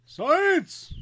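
loud, high exclamation of Science!; mock triumphant shout; exclaiming. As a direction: belt out Science! like a slogan.

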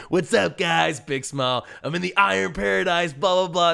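high, loud, grinning hype voice; beaming gym-bro welcome; The Rock impression. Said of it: "It's my Rock impression".

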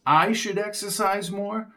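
high, disbelieving question; incredulous echo. Acted. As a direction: repeat it in disbelief. Jones echoes in disbelief.

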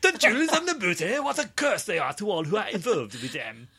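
put-on high, loud German-accented captain; hammed-up German accent. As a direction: shout it in a bad German accent. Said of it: "That was German".

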